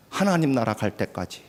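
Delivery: ordinary talk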